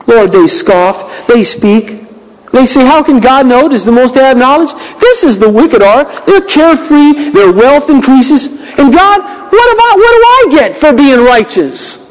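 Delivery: a touch of self-pitying whine; whining complaint. Acted. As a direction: complain to God, aggrieved. Voicing Asaph's complaint.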